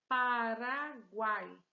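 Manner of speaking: Paraguai loud and slow, Pa-ra-guai; syllable by syllable. Shown as a demonstration. Teacher announcing a word to students, Paraguai loudly sounded out. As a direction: punch out each syllable of Paraguai. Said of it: "Três sílabas".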